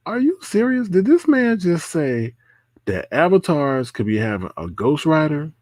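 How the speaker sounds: high-pitched mock disbelief, easing downward; incredulous. Reacting to the claim that avatars have ghostwriters, incredulous.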